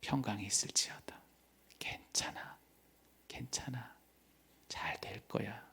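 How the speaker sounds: soft, hushed blessing; Jesus' gentle whisper of peace to believers; tender whisper. Acted. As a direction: whisper comfort close to the listener. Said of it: "우리에게 들려주시는 그 말씀".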